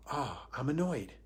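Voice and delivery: softly observe "Ah, I'm annoyed"; quiet, matter-of-fact self-observation of annoyance; detached noticing. Shown as a demonstration. Teacher shows watching oneself be annoyed, detached noticing. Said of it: "Something is watching the annoyance".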